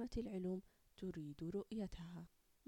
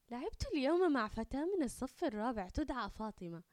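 put-on higher, louder, delighted child's report; very happy; 4 semitones higher, 6 dB louder than usual. A narrator's reading. Samar tells her parents about a new friend, very happy. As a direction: burst out with happy news. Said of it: "فرحة جدًا وقالت".

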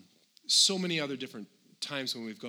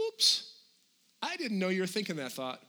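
mock surprise; 7 semitones higher than usual. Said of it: "do you think God goes".

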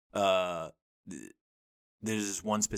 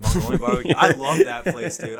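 much louder and higher, bursting with delight; a sudden happy shout of recognition; excited; 9 semitones higher, 15 dB louder than usual. Real, not acted. Excited about a childhood restaurant.

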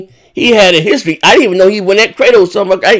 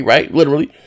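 usual speaking voice

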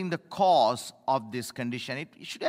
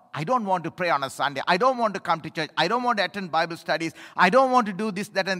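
mock grumbling; faster than usual. Mimics reluctant churchgoer.

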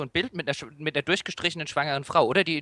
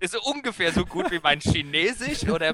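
laughing; 4 semitones higher than usual. Real, not acted. Laughs at his own French.

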